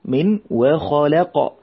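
normal talking voice

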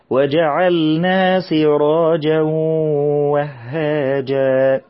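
very slow chanted recitation, doubled meem held; Quran recitation. Shown as a demonstration. Teacher models the verse for children, slow chanted recitation. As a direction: chant it slowly as in tajweed class.

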